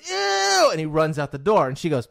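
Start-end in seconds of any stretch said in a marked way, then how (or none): 0.1-0.7 put-on high, loud kid's ew; grossed-out ew. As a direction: recoil like a disgusted boy.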